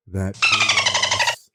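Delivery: big-ass drawn out very slow and squeaked up very high and loud; milk big-ass as a huge exaggerated squeal; stretched emphasis. Narrator bartering with the carnie for the biggest panda, drawn-out squeal on big-ass.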